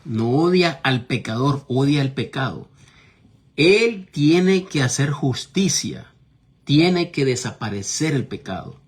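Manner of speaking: full-force sermon delivery; forceful preaching. Acted. Preaching hard about sin.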